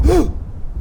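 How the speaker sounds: very high sudden gasp; sharp high gasp. Real, not acted. Gamer spotting a creature, gasping high. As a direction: gasp as something looms.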